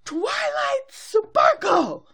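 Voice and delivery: high, drawn-out booming shout; Princess Celestia. Retold or imitated. Princess in the sky summons her student, high long shout. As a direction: call it out huge and slow from above. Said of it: "Princess Celestia in the sky going".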